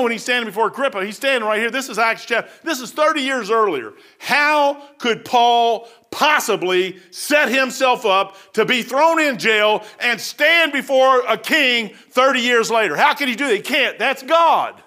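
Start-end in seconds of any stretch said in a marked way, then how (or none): 4.2-13.0 slow emphatic. Acted. Dramatic preaching emphasis.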